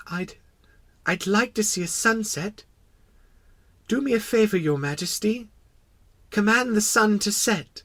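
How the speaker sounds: put-on high, hesitant child voice; venturing little prince. Little prince asking the king a favor, tentative. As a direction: plead shyly in a high voice. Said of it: "he ventured to ask a favor".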